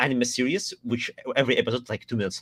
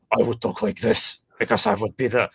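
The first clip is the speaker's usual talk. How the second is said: monstrous symbiote voice; Venom. Shown as a demonstration. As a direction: talk like an alien creature living inside someone. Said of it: "my first voice impression was Venom".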